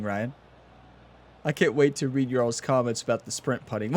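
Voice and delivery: normal talking voice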